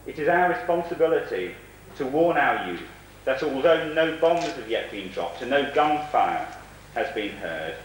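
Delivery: ordinary talk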